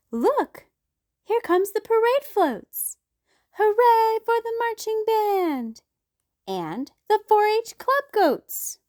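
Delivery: high, loud parade excitement; excited child. A narrator's reading. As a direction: point and cheer at the floats.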